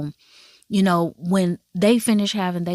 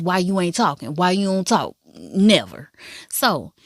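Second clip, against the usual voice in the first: ordinary delivery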